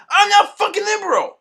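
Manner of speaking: high, loud indignant shout; retold yell. Yelling at the coworker as he walks away. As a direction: scream the denial at him. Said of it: "I was like".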